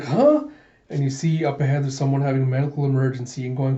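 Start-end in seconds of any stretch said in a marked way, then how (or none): 0.0-0.5 high, louder rising yelp; sharp upward double-take; startled. Retold or imitated. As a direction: squeak it in disbelief. Alita's second startled reaction.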